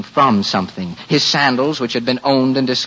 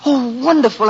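higher, thrilled storyteller; delighted wonder; 9 semitones higher than usual. A narrator's reading. Narrator shares the boy's imagined miracle, delighted. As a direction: marvel at the daydream.

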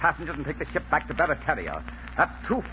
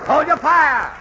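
loud commanding shout; shouting orders; 12 semitones higher, 11 dB louder, slower than usual. Acted. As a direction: bark the order across the deck. Captain ordering crew to cease.

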